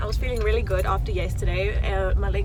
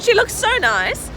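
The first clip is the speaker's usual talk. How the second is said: high, loud, slow gush; 13 semitones higher, 10 dB louder than usual. Real, not acted. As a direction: rave about her friend. Gushing about friend.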